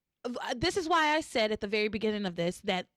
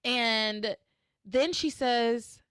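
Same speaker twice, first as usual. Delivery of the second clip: usual speaking voice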